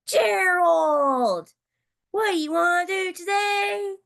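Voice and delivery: high, loud, eager shout to a friend; calling Gerald out to play; bright shouted greeting; excited call. Acted. Piggie calls out to Gerald.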